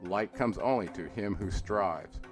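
ordinary delivery, nothing marked

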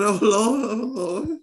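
high, hammed-up sobbing; mock crying. Father acting out crying over his son, high mock sobs. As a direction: wail to the Lord in a put-on cry. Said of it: "I cry like shit".

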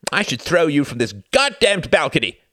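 high, loud enraged outburst; furious cry. A narrator's reading. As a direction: yell the threat, fully losing it. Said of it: "cried Reginald".

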